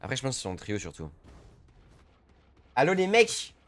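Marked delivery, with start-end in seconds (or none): 2.7-3.5 loud, high, rising hail; calling out loudly. Real, not acted. Player hails silent teammates, loud rising call-out. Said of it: "Non, calme-toi, ça va".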